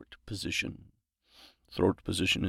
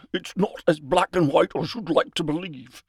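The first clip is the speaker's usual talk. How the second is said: higher, louder put-on character voice; theatrical, raised-voice line read; in-character retort; 7 semitones higher, 4 dB louder than usual. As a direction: deliver the line pitched up and pushed.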